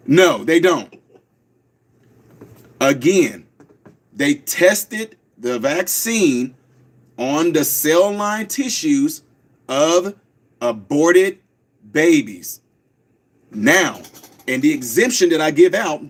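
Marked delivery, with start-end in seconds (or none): 2.8-12.6 slow, deliberate, word by word with pauses; said real slow. Shown as a demonstration. Host repeats it slowly for the audience. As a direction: lay it out slowly again. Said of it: "I'll say it real slow".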